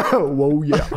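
Lanny Poffo impression. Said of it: "can you please say the Macho Man's quote".

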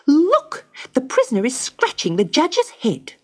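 high, fast, indignant bird voice; magpie. A narrator's reading. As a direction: snap it like a cross magpie. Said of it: "said the magpie".

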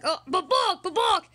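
high, loud clucking cry; rooster squawk. Pretend. Rooster fixing a voice crack, high loud squawks.

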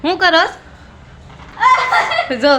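very high, very slow laughing shout. Real, not acted. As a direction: call out loud through a laugh. Teasing kids playing.

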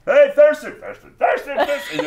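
high, loud calling out; crowd yelling. Acted. Gilligans calling for Thurston, high repeated yells. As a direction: holler the name like a crowd passing it along. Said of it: "And they're yelling".

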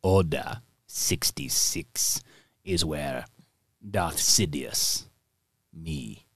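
low, slow sinister emperor. Retold or imitated. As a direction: creep it out low like Palpatine. [name] voices Emperor Palpatine.

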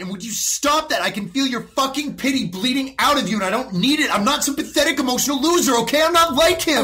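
rising, loud and furious, voice climbing high; angry outburst. Acted. Teen snapping at his therapist, angry and loud.

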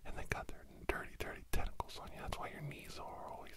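ordinary talk

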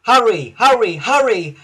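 pressing, puffed cries; panted urging. A narrator's reading. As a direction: urge on as if puffing hard. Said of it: "panted Thomas".

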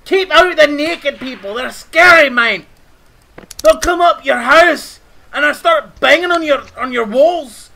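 loud, heated telling-off; exasperated rant. Pretend. Warning a friend about naked intruders, exasperated rant. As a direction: rant it out loudly, word by word. Ranting at intruders and ramp building.

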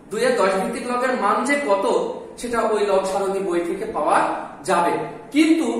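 ordinary talk

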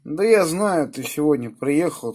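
usual speaking voice

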